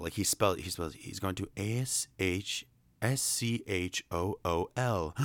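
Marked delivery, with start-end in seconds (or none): 1.6-5.2 letters "A S H S C H O O L" called out one by one; spelling out. Retold or imitated. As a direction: read each letter like Owl misspelling it. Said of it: "Owl actually spells out the word school".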